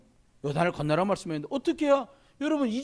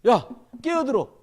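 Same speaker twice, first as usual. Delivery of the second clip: firm raised call to wake up; waking a kid; 5 semitones higher, 6 dB louder, slower than usual. Retold or imitated. Pastor rousing a sleeping teen, firm call. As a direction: nudge the sleeping kid awake. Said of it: "어떤 애는 또 자요".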